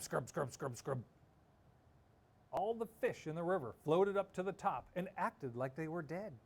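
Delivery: ordinary delivery, nothing marked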